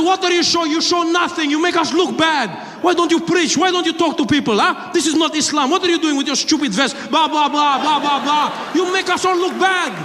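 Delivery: put-on high, accented indignant tirade; scolding passenger. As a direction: berate him in a put-on Middle Eastern accent. Acts passenger scolding terrorist.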